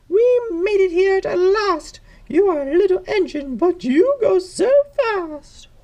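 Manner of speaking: high-pitched, loud judge character; high voice praising the little engine; delighted judge. Acted. Judge arriving at the show, high delighted praise.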